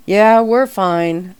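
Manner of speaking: slow, loud call back. A narrator's reading. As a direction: call it to the road. Calling back to stranger.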